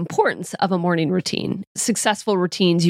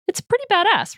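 excited; 13 semitones higher than usual. Acted. Imagining driving a Ferrari.

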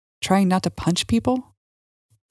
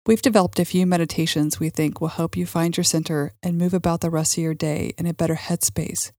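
normal talking voice

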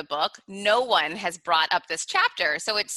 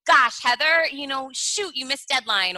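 harsh self-talk; 6 semitones higher, 3 dB louder than usual. Retold or imitated. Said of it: "I would say".

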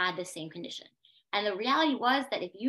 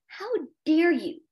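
higher, louder, stung outrage; indignant protest, pitched up; retold indignation; 6 semitones higher, 5 dB louder than usual. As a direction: throw the accusation back at them, voice raised. Retells her outrage at being doubted.

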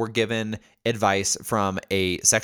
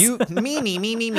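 mock me me me; 12 semitones higher than usual. Mocking self-centred me me me.

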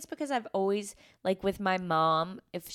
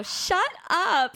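higher, louder embarrassed shut up; dismissive brush-off; 9 semitones higher, 4 dB louder than usual. Retold or imitated. Brushing off her husband's wedding speech, embarrassed snap. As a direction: swat the compliment away.

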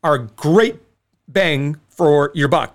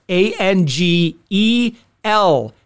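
slow letter-by-letter spelling; spelled out slowly; much slower than usual. Acted. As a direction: spell each letter out deliberately. Spelling out the promo code.

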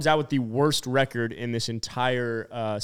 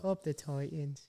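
slow, hushed mock British accent; retry the British accent slowly and much softer; 11 dB quieter, slower than usual. Host trying a gentler take after coaching, soft British accent. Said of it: "more softer with it".

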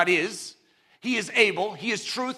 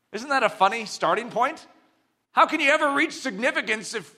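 normal talking voice

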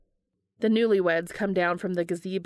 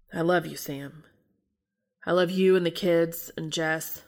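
lightly voiced brother's farewell; 3 semitones lower than usual. Dean's farewell letter voice.

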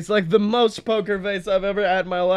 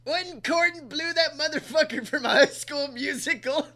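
Guy Fieri voice; 7 semitones higher than usual. Pretend.

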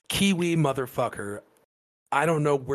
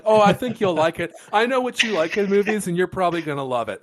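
mocking friend's voice; 10 semitones higher, 6 dB louder than usual. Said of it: "You're like".

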